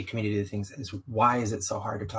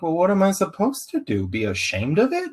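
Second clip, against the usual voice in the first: incredulous retort; 9 semitones higher, 5 dB louder than usual. Retold or imitated. Retorting to people amazed he's open.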